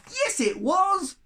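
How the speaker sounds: slow, high, loud taunt; cheeky snowball. Acted. A snowball taunts the yetis, high and loud. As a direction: tease the yeti from the shadows. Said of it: "said a snowball".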